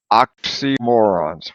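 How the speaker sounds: oxymorons drawn out slowly in clear parts; slow model. Shown as a demonstration. Teacher models the next vocabulary word slowly. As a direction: sound out oxymorons part by part. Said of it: "Let's all say it".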